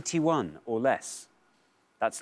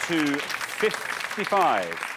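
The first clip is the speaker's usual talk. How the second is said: high voice over applause, slowing for the total; excited score announcement; 6 semitones higher than usual. Real, not acted. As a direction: build up to the final total. Host announces the winning score.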